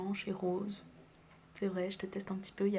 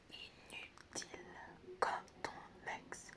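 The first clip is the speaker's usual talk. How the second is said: teasing whisper; slower than usual. Acted. Teasing about the ex.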